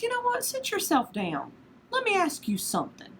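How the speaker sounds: a hint of a God putting Job in his place; stern God voice, lightly. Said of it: "God says".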